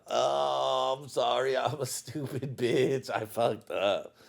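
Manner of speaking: half laugh the apology away; mock apology. Retelling his apology to his wife, sheepish and laughing. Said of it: "you know".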